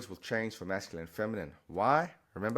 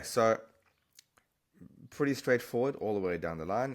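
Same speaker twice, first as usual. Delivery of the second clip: ordinary delivery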